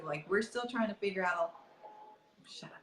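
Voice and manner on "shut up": whisper. Real, not acted. Whispered aside.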